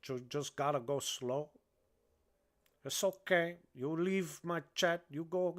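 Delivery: put-on lightly held Cuban gangster accent; a hint of the Cuban accent, half-crazed and joking. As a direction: keep the Cuban accent, loose and rambling. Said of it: "my accent".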